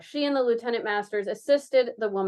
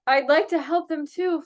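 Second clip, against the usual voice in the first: higher, eager announcement; excited announcement; 7 semitones higher, 4 dB louder than usual. Acted. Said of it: "Floriana announced excitedly".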